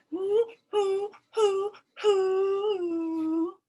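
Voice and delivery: high monkey hoots; excited monkey. Acted. Teacher voicing the monkey for the class, high hoots. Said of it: "Chunky Monkey was like".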